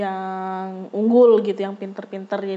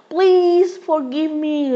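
higher, louder, very slow begging; pleading; 6 semitones higher, 5 dB louder, much slower than usual. Retold or imitated. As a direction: plead pitifully for forgiveness. Kayak mengiba gitu. (memelas memohon maaf)

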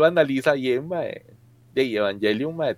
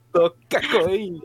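normal talking voice

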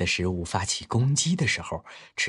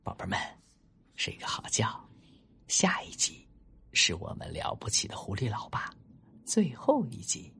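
hushed, half-whispered goodnight; bedtime sign-off; 5 dB quieter than usual. Real, not acted. Storyteller wishes children good sleep until the next episode, hushed. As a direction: lull the little ones with soft, breath-only words.

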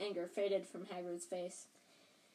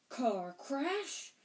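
higher, outraged roar of disbelief; angry roar; 6 semitones higher, 4 dB louder than usual. A narrator's reading. Hagrid jumping up at the Dursleys' lie, roaring. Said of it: "roared Hagrid, jumping up so angrily".

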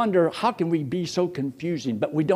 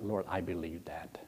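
soft, low whisper to God; whispered prayer; 8 semitones lower, 13 dB quieter than usual. Shown as a demonstration. A believer telling God, whispered. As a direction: breathe it as a private prayer.

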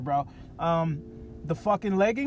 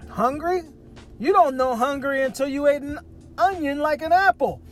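put-on high, louder old grandpa scolding; grandfather's retort; 7 semitones higher, 4 dB louder than usual. Ninety-two-year-old grandfather scoffs at his grandson's complaint, high and loud. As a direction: voice the tough old man scoffing at the word hungry. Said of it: "he's like".

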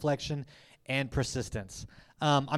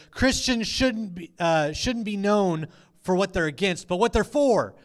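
louder, higher conference proclamation; imitated rally cry; 5 semitones higher, 6 dB louder than usual. Imitating a WinterCon speaker firing up the crowd, raised proclaiming voice. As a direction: mimic a rousing speaker working the crowd.

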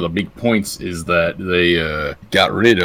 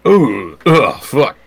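exasperated; 5 semitones higher, 5 dB louder, much slower than usual. Real, not acted. Reacting to ride price.

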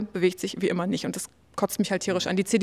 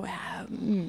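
a very soft, dismissive "Ja, hm" as if Germany shrugs; mock indifference, barely voiced; uninterested muttered hum; indifferent shrug; 10 dB quieter than usual. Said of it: "denkt sich so".

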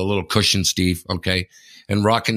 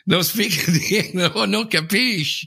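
higher, laughing fake-foreign voice; mock foreigner broken English; 8 semitones higher than usual. Laughing as he pretends to be a foreigner who knows no English.

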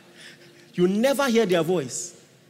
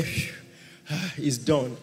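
soft, lazy relieved sigh; hammed-up relief; mock relieved sigh; 6 semitones lower, 4 dB quieter than usual. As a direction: imitate a lazy pray-er finishing. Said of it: "then after twenty seconds say".

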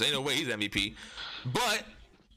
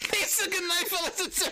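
high, laughing goodbye; laughing sign-off; slower than usual. Real, not acted. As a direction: say goodnight through laughter.

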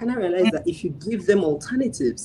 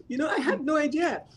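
higher, laughing through the words; 10 semitones higher, 4 dB quieter than usual. Real, not acted. Telling the host she had no idea, laughing higher. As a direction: let the laugh spill into it. Still laughing after surprise.

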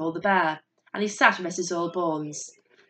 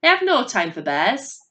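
louder, higher irritated dismissal; impatient, raised snap; snapping; 5 semitones higher, 7 dB louder than usual. A narrator's reading. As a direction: send the visitor away crossly. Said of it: "she snapped".

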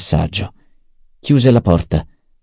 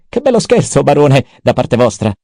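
Peter the mentat; 5 semitones higher than usual. A narrator's reading. Mentat Peter reporting to the baron, high and sneering. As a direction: needle the baron in a high mocking tone. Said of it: "Freddamente il barone disse".

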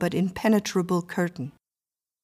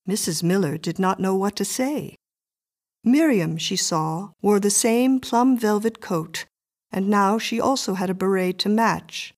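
plain reading voice

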